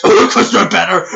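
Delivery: loud boast barked through a fake cough; cheeky coughed gloat; jab disguised as a cough. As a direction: sneak a brag into a cough. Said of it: "I am so sorry about these coughing".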